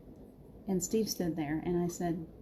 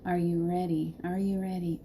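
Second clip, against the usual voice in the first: ordinary talk, nothing marked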